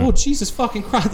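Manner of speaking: high, loud mock exclamation of disbelief; retold gasp of amazement at a blunt truth; amazed exclamation. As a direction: blurt it out like you can't believe how true it is. Reacting to a blunt proverb.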